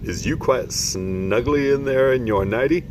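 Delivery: lightly voiced friendly giant; kindly giant asking after a child; BFG giant voice. BFG voice in story reading.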